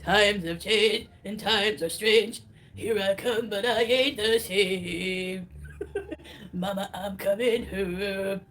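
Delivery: slow, sung put-on rock voice; Sam voice. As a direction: croon it slow in a borrowed voice. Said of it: "That's my Sam voice".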